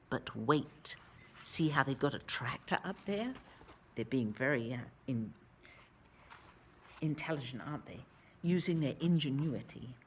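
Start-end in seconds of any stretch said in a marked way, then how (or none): 0.1-1.0 low suspenseful whisper. A narrator's reading. Narrator whispers the suspense to the children. 1.4-8.0 fast, low whispered aside. Real, not acted. Reader whispers to a child about the picture.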